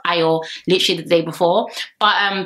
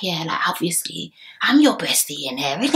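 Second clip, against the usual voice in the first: raspy Josh impression; 3 semitones lower than usual. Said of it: "Why is he talking like that?".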